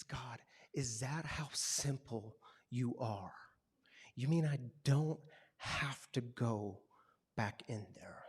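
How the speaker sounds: slow, hushed whisper of wonder; soft, slow, amazed whisper; awed whisper. Retold or imitated. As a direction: whisper it in disbelief to God. Awed realization, pin-drop moment.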